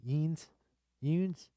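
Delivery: "Eens" as a high, swooping "yeenz" in a Pittsburgh accent. Shown as a demonstration. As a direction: demonstrate Pittsburgh "Eens" as one long "yoonz". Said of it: "What's the Pittsburgh accent?".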